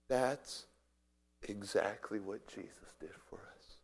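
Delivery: low, soft, weighty statement; hushed. Real, not acted. Preacher likening the sacrifice to Jesus, hushed. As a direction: pause after the first word, then say it quietly. Preacher lands the parallel softly.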